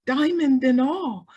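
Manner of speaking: ordinary talk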